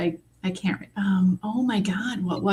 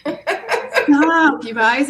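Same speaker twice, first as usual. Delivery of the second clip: laughing, higher, stop teasing me; laughing plea to stop; laughing protest; 7 semitones higher, 4 dB louder, much slower than usual. Real, not acted. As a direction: giggle out the word at friends. Laughing as friends tease her.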